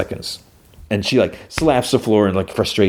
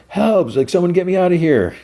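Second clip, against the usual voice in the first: high pitched mock cry for help; mock yell for help; 10 semitones higher than usual. Daniel caged among barking dogs, mock yelling for help. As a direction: cry out from the cage, hammed up. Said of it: "He starts yelling".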